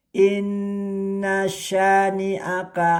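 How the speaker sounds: Inna syani'aka with nn held long, slow and high; held doubled n. Shown as a demonstration. Teacher reciting for students, nn of Inna held. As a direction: stretch the nn in Inna, slowly. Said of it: "cara membacanya ditahan tiga harokat".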